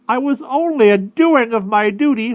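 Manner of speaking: high, loud whiny protest; whining crow. A narrator's reading. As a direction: protest in a squawky whine. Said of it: "whined the crow".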